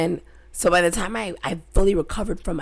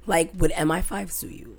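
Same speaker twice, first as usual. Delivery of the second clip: normal talking voice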